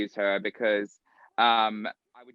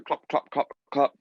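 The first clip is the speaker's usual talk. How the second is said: mouth clop sounds; 2 semitones higher than usual. Shown as a demonstration. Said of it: "say it for me one more time".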